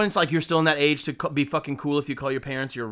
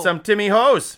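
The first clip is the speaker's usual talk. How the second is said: loud, higher hammed-up Canadian accent; bad Canadian accent; 7 semitones higher, 7 dB louder, slower than usual. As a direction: belt out a bad Canadian impersonation. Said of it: "It's a bad accent".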